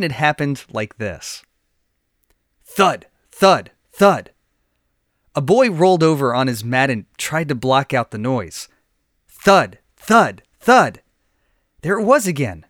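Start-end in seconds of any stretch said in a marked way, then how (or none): 2.6-4.3 loud, heavy thud on each word, like a fist on a door; three slow banging thuds, louder than the narration; loud knocking thuds. A narrator's reading. 9.3-11.0 loud, heavy thud on each word, like a fist on a door; three slow banging thuds, louder than the narration; loud knocking thuds. A narrator's reading.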